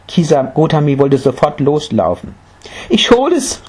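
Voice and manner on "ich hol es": very high, eager cry; joyful call. A narrator's reading. Mother rushing off for the mustard seed, joyful. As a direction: call out happily, pitched right up. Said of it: "rief sie freudig".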